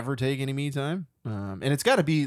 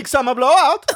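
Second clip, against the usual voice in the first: higher, louder Oaken impression; Frozen shopkeeper voice; 12 semitones higher, 9 dB louder than usual. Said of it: "Oh, the shop guy".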